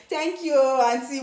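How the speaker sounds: drawn-out, pointed "thank you, auntie"; sassy thanks. Retold or imitated. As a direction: thank her with attitude. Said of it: "with all attitude and everything".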